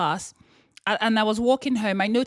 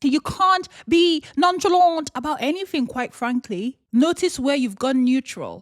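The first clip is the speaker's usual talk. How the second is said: ordinary talk, nothing marked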